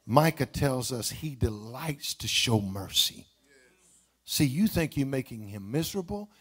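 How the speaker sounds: ordinary talk, nothing marked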